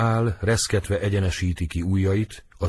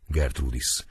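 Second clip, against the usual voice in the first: ordinary delivery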